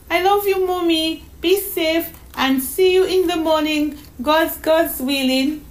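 put-on high child's farewell; sweet, high, slightly halting goodbye; loving little girl. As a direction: say goodbye to Mommy like a small girl. Voices young Donna May in story.

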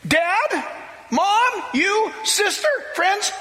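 impassioned shouting plea, pitched high; fervent shout. Retold or imitated. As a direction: shout it out to the whole room like a new convert on fire. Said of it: "He said".

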